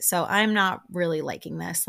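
ordinary talk, nothing marked

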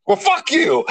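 high-pitched mock shout of a rude guy; retold yell. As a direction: bark it back like the line-cutter. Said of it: "He looks right at me and goes".

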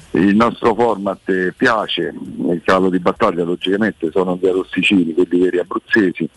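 ordinary delivery

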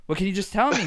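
pleading whine. Retold or imitated. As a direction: beg him to just say it. Pleading with dad, raised pitch.

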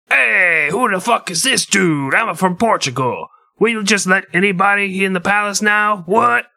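Portuguese king voice. Retold or imitated. King snapping at Columbus who barges into his palace, high put-on voice. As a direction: play a haughty king offended at the intruder. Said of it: "That's how he talked".

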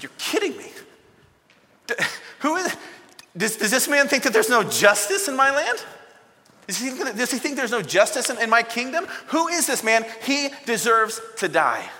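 high, loud, furious royal outburst; heated outrage. Retold or imitated. King David erupting at Nathan's story, furious and loud. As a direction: rage at the injustice, voice climbing. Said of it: "David was heated".

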